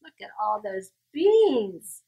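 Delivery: plain reading voice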